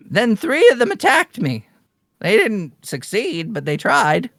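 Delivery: high start, settling as the tale ends; lightly voiced old woman.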